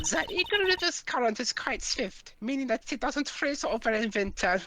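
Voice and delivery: put-on Von Karma, German accent. Player voices Von Karma in a game read-aloud, high German accent. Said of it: "doing my stereotypical German voice".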